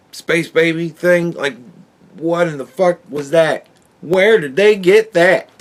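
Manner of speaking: ordinary talk, nothing marked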